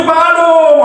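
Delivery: exasperated husband. Retold or imitated. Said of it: "Ada orang ngomong".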